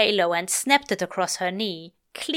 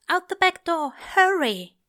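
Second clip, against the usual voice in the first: high, pressing plea to hurry; urgent angel; 9 semitones higher than usual. Acted. Angel hurrying Crowley out the back door, high and urgent. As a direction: push him out fast. Aziraphale urges Crowley to flee.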